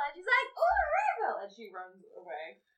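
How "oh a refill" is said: very high, loud delight; hammed-up sudden excitement; excited drunk woman. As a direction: squeal it like a thrilled tipsy girl. Drunk woman distracted by refill offer.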